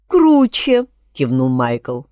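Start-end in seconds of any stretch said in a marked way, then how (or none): 0.1-0.9 eager. A narrator's reading. Maikl agrees eagerly.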